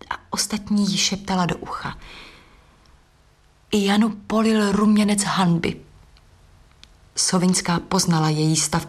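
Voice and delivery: plain reading voice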